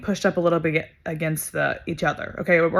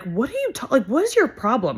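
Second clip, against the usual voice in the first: higher-pitched, incredulous challenge; indignant confrontation; 6 semitones higher than usual. Retold or imitated. Bystander defending a sobbing girl from a man, indignant. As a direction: retell calling someone out, pitched up.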